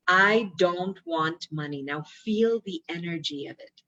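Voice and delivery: ordinary talk, nothing marked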